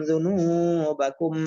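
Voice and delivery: দুনুবাকুম as one word, long নু; joined reading. Shown as a demonstration. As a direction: flow through দুনুবাকুম, holding the নু. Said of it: "চালু করে দিলে reading হয়ে যায়".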